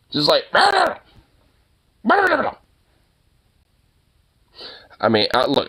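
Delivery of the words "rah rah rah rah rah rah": high-pitched mock yapping; imitated dog barking. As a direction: mimic a dog barking at nothing. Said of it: "here you are just doing that".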